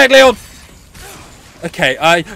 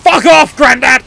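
loud, raised-pitch outburst; irritated shout; 3 semitones higher, 4 dB louder than usual. Real, not acted. Player yelling at an enemy villager, irritated shout. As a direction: shout him away.

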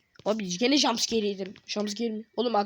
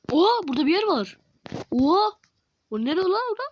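surprised; 8 semitones higher, 6 dB louder than usual. Real, not acted. Discovers new place in game.

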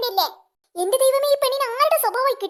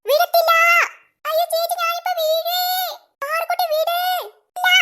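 panicked cry; 5 semitones higher than usual. Acted. About to fall off swing.

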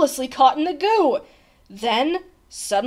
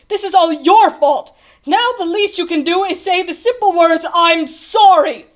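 loud, high accusation; scolding; 4 semitones higher, 9 dB louder than usual. A narrator's reading. Bartholomew demands that the king apologise, scolding. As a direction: lay the blame on him forcefully.

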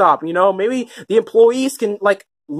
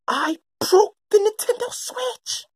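mock tearful confession; 8 semitones higher, 5 dB quieter than usual.

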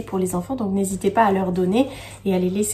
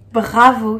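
usual speaking voice

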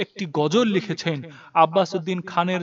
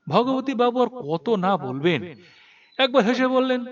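ordinary delivery

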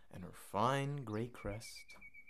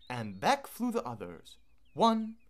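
ordinary delivery, nothing marked

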